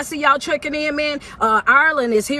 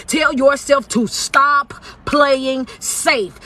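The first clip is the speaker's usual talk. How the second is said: slow, every word spaced for the note; slow dictated command; much slower than usual. Shown as a demonstration. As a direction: dictate it slowly so they can write it down. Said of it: "the first note that I need you to write down".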